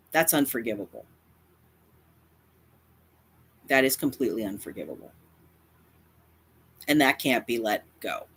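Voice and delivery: low, grave and final; grave condemnation. Real, not acted. Content creator condemning a rival for hurting their friends, low and grave. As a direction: deliver a low, solemn verdict.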